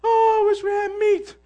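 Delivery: hammed-up crying for meat; mock crying. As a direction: wail it like a grown man crying.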